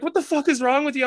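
high-pitched startled exclamation; alarmed demand. Retold or imitated. Partner rushing in after hearing screams, alarmed. Retold partner finds him naked.

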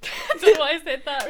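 laughing loudly through the words. Real, not acted. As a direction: laugh as you speak. Both laughing at plug.

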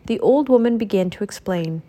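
ordinary delivery

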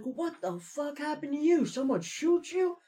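high, slow alarmed coworker voice; coworker's startled shout. Retold or imitated. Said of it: "he's like".

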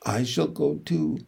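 very soft, understated narrated voice; softly. A narrator's reading. Charlotte interrupts the geese, softly. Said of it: "she said softly".

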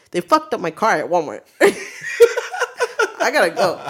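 loud, indignant complaint breaking into laughter; mock-urgent loud announcement; retold outburst. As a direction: act out blurting bad news to the boss. Acting out outburst to manager.

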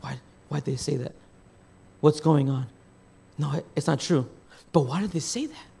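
low, soft self-questioning; quiet, troubled thinking aloud; wondering inner thought. Acted. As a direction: voice a wife's private doubts, hushed and low. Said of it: "she's gonna think".

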